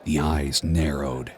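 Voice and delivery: usual speaking voice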